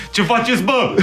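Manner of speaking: high, laughing imitation of rapper swagger; laddish rapper. Artist mimicking rapper entering studio, amused mock swagger. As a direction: mimic the cocky greeting through laughter. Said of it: "Când se duce să".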